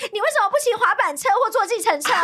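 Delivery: high-pitched retold nagging; exasperated demand. Customer asking running friend why no taxi, exasperated. Retelling her scolding of her friend.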